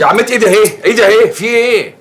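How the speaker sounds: high, loud pleading; a loud, high panicked plea to the aunt; panicked protest. Acted. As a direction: swear innocence in a high yelp. Caught, protests innocence.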